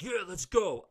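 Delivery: hammed-up bring-it-on challenge; mock fight-ready. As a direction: play it like you're ready to brawl. Hypothetical fight acceptance.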